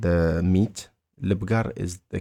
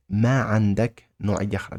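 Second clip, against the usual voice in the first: ما عندك نوعية أخرى broken into parts, pitch near usual; word-by-word demonstration; much faster than usual. Shown as a demonstration. Teacher breaking down the question for a learner, word by word. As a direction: separate ما and عندك, then finish the phrase.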